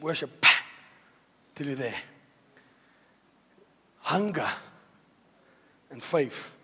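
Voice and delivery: soft altar-call murmur; hushed worship. Acted. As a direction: pull them into quiet worship. Hushed worship after the climax.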